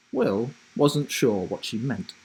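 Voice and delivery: ordinary delivery, nothing marked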